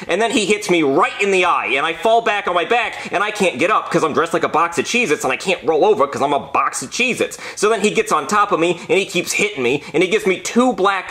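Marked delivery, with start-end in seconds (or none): none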